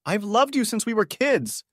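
high-pitched, strained love confession; cracking yell. A narrator's reading. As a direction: cry it out high, like a desperate drunk guest. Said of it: "he yells, his voice cracking".

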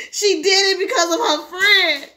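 high, loud, slow whine; tearful accusation. Acted. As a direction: wail it out to the policeman. Whimpering boy tells police.

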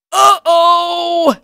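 high, loud alarmed cry; panicked uh-oh. Acted. Espoir realises the danger, crying out high and loud. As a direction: shout it as panic hits.

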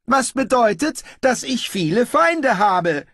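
high, loud ruler's voice; pompous ruler. Acted. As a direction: proclaim it high and loud, sure of your power. Pompous ruler argues back. (herrisch und selbstgefällig)

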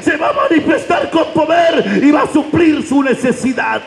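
high, ringing proclamation; preaching at full force. Acted. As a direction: shout the promise over the congregation. Preacher's climax. (proclamación a gritos)

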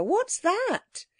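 high-pitched, astonished question; startled cry. A narrator's reading. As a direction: pitch leaps up in alarm. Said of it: "cried Anthea".

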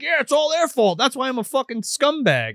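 high-pitched mock defiance; retold defiance. Prisoner answers the doctor's blame question, defiant imitation. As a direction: throw the blame back, imitating him.

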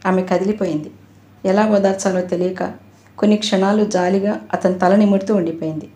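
ordinary delivery, nothing marked

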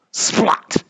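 high, splattery exclamation; explosive splat; splat sound word. A narrator's reading. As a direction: make the word hit like mud. Sound of landing in mud.

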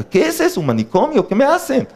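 put-on high, alarmed little child's questions; a frightened kid in the clinic; mock scared child. Child at the vaccine clinic asks in fright.

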